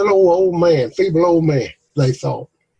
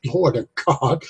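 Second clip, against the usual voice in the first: ordinary talk, nothing marked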